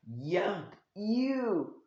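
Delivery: acted disgust rising high on "ew"; mock disgust. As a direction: recoil with a high, drawn-out ew. Raven is disgusted by Sasuke.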